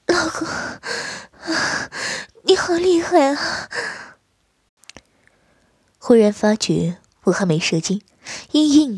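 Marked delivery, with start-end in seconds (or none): 0.0-3.7 exhausted, barely getting the words out; slow, high, drained voice; weak and spent. Acted. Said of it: "无力地说道".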